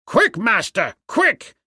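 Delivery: high, urgent panicked priest; frightened cry. A narrator's reading. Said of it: "A frightened priest burst into the apartment".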